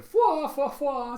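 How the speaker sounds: loud, very high "fwa" laugh; put-on cartoon laugh in high bursts; Killer's laugh. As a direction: hoot each fwa way up high. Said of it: "you have Killer going".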